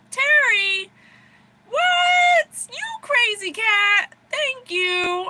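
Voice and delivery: loud, high-pitched thrilled shout; over-the-moon high holler to a friend; excited squeal. Real, not acted. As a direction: shriek with delighted disbelief, then call out thanks. Thrilled at a viewer's gift.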